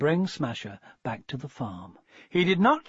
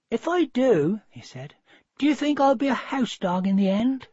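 put-on high, hopeful young dog voice; high pup voice, hopeful; puppy's voice; 8 semitones higher than usual. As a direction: ask like an eager pup. Puppy asks mother.

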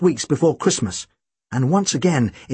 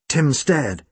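plain reading voice

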